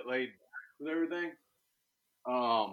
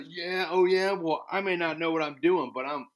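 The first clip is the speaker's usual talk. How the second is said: mock boast, higher and louder; cocky braggart; 5 semitones higher, 7 dB louder than usual. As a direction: ham up a know-nothing show-off. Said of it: "I'm not gonna be the guy that goes".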